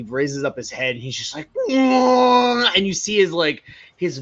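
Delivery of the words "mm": rising grunt. Acted. Reggie starts transforming.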